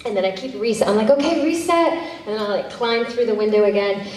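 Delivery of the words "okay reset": lightly acted director, pitched up; calling directions. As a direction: call a higher-pitched reset to the crew. Retells calling directions on set.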